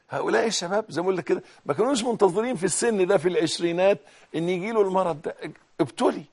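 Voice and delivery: usual speaking voice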